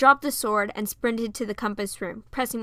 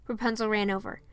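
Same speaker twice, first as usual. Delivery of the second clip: plain reading voice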